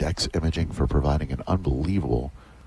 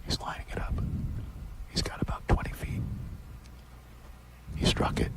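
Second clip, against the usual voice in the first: whispering; 6 dB quieter than usual. Real, not acted. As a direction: whisper the call of the putt. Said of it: "We're gonna be real quiet".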